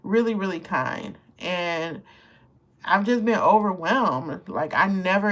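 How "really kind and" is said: normal talking voice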